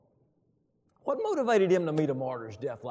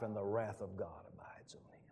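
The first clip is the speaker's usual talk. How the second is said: solemn whisper; 4 semitones lower, 11 dB quieter than usual. Acted. Solemn warning after loud verse.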